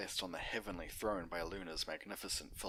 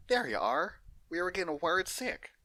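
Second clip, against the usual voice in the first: Applejack voice; 11 semitones higher, 7 dB louder than usual. Acted. Applejack greeting Big Mac.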